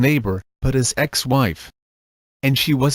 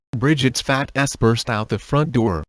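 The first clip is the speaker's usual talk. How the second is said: ordinary delivery, nothing marked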